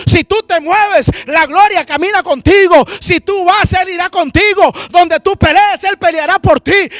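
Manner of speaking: loud, climbing exhortation; rally a fallen king to fight; rousing prophetic preaching. Acted. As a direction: punch each command, stretching the key words.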